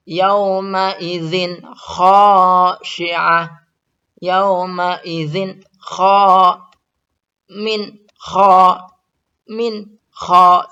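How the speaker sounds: "Yauma izin khashi'ah" slow, high and loud; slow recitation. Shown as a demonstration. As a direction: keep the tanwin clear before kha. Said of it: "contoh selanjutnya". (tanwin jelas pada "Yauma izin khashi'ah")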